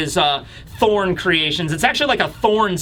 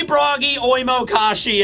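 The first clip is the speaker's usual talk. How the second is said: normal talking voice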